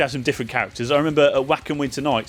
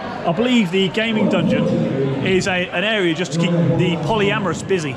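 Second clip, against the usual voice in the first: laughing; 6 semitones higher, 4 dB louder than usual. Real, not acted. Joke about the gaming dungeon.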